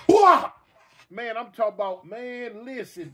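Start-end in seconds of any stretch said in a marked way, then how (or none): 0.1-0.5 loud sharp slap noise; imitated slap, loud burst; slap sound. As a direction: smack it out like a hand hitting a head.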